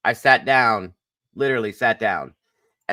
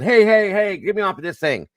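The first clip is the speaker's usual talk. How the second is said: retold yell; 10 semitones higher than usual. Yelling at the ride operator who didn't come back, panicked. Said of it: "I'm yelling".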